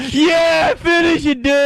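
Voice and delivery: put-on high squeaky drunk girl chiming in; high mock heckler. Mimicking a drunk heckler, high and eager.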